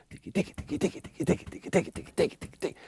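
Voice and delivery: whispered rapid "tiki" ticks; hi-hat beatboxing. Shown as a demonstration. Producer counterpoints the kick, whispered hi-hat imitation. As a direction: tick out hi-hats voicelessly, very soft.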